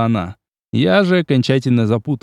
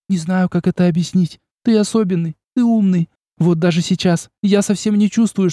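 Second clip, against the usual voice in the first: put-on higher, emotional young woman; Olga confessing; 7 semitones higher than usual. A narrator's reading. Olga confessing to the prince, higher and emotional. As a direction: pour out her feelings shyly.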